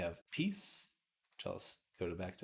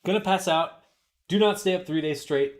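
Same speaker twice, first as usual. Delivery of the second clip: loud mock voice; 9 semitones higher, 12 dB louder than usual. Reading chat in loud mock voice.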